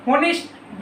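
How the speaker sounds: होनेस्ट with a sounded h at the start; wrong pronunciation. Shown as a demonstration. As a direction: say होनेस्ट with an audible opening ह. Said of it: "बिल्कुल नहीं बच्चों".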